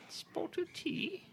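very high, squeaky British voice; mock British accent. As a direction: put on a silly falsetto Brit. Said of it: "I'm miming a British person".

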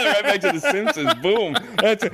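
laughing. Real, not acted. Mock ending the podcast early.

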